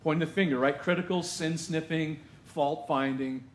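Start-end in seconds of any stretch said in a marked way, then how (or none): none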